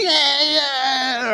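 high, whiny mock cry; whining kid. Pastor imitates a whining kid at the shop, mock crying. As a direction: whine like a kid wanting something. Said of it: "and the kid's".

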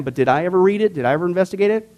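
ordinary talk, nothing marked

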